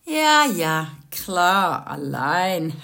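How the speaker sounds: little brother teasing his sister about the pizza boy, mocking. A narrator's reading. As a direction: tease your sister with knowing disbelief. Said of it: "sagte Max und blickte auf den Pizzajungen".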